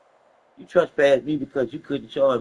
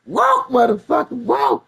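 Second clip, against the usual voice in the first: higher, louder barked order; mock cop shout; 10 semitones higher, 5 dB louder, slower than usual. Cop ordering the paralyzed man to walk, loud mock shout. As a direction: bark it like an angry cop. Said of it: "that boy said".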